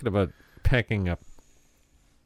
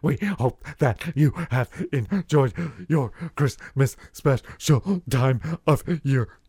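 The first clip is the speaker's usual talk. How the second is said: mock announcer sign-off; 2 semitones higher, slower than usual.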